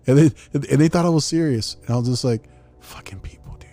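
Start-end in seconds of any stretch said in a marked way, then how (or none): 2.8-3.7 whispered aside. Retold or imitated. Muttered aside to self.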